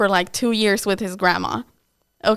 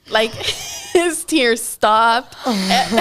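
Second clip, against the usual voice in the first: ordinary delivery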